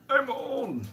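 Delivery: high mock wail about age; mock despair. Real, not acted. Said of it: "I'm forty. One".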